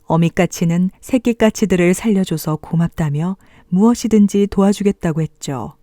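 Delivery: usual speaking voice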